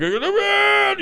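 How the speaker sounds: very high yelled cry; 1 screaming. Pretend. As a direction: yell it in pained frenzy. Scraping antlers on trees.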